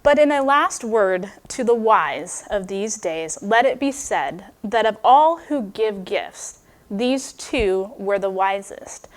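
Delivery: slow, measured quoting of the final lines; author's closing words, read slowly and solemnly. Acted. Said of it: "But he says this".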